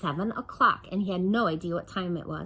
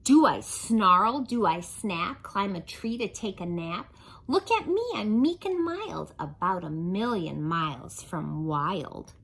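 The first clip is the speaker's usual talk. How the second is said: lively singsong rhyme reading; reading to children; 3 dB louder, slower than usual. A narrator's reading. Storyteller reading a rhyming book to kids, lively. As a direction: read the rhymes to the kids with bounce.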